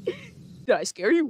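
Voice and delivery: creepy voice. Retold or imitated. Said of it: "What did this man just say".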